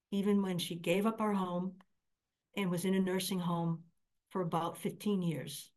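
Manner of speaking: usual speaking voice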